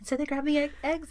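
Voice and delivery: usual speaking voice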